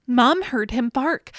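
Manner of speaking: plain reading voice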